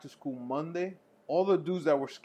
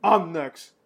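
loud claim; 3 semitones higher, 10 dB louder, much slower than usual. Retold or imitated. Inmates claiming lunch.

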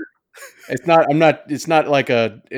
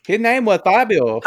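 higher, louder laughing fake accent; mock Russian accent; 10 semitones higher, 6 dB louder than usual. Asked to read the romance line in a fake accent. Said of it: "let's hear it".

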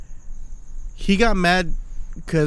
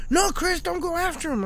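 high mock crowd; 12 semitones higher than usual. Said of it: "And everyone's like".

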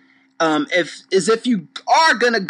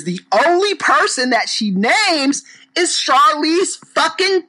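worked-up rant; 7 semitones higher than usual. Real, not acted. Ranting about one-person trend.

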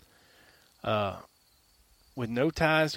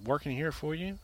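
softer, confidential warning to an employer; hushed FBI warning; 7 dB quieter, faster than usual. Retold or imitated. Agent warning his employer, hushed and confidential. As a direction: lean in and drop the voice like a snitching agent.

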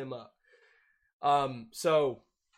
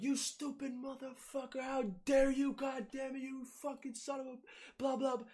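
mock furious rant; 13 semitones higher than usual. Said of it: "And Lorenzo's like".

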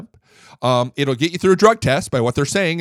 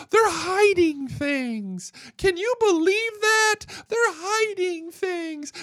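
much higher, slow, whining mock voice; mocking whiny Democrats; slower than usual. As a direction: sneer at them with a crybaby whine. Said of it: "And yet, what do you do?".

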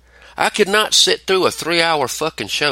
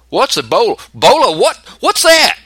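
clueless host; 8 semitones higher, 6 dB louder than usual. Retold or imitated. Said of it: "and goes".

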